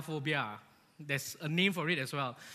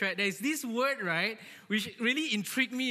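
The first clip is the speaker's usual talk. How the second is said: ordinary talk, nothing marked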